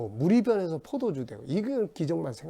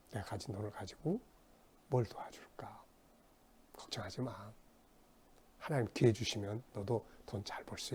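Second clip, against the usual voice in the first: gentle reassurance; 3 semitones lower, 7 dB quieter than usual. Retold or imitated. Rich man gently offering help.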